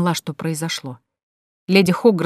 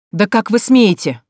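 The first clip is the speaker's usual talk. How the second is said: slow, louder indignant bark; 4 semitones higher, 5 dB louder, slower than usual. Acted. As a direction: bite out each word in outrage. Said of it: "рявкнула я". (гневно, рявкнув)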